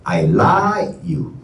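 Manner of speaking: slow, with "like" lifted high and falling; stress on like. Shown as a demonstration. Teacher shows the class the second meaning, stress on "like". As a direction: stretch and lift "like" in "I like you". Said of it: "When you stress on".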